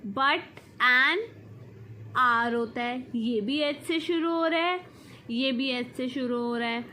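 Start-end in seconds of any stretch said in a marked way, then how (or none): none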